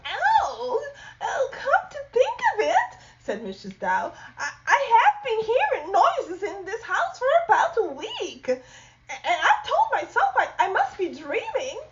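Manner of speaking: high, laughing woman's voice; Missus Dow. Acted. Missus Dow laughing off her husband in a high voice. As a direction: giggle at his silly idea.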